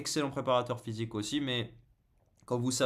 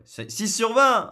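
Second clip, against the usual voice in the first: indignation; 12 semitones higher, 11 dB louder, slower than usual. Real, not acted. S'indigne contre la note du chat.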